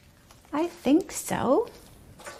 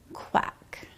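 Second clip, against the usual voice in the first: whispered riddle clue; hushed guessing-game question; 16 dB quieter, slower than usual. Acted. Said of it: "riddle whispered".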